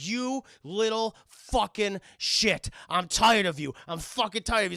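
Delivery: loud cursing, drawn out at first; acted fury. Burglar to the kid atop the stairs, acted fury. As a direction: stretch each insult in mock rage.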